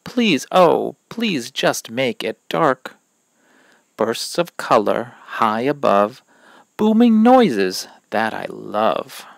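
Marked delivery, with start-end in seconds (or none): none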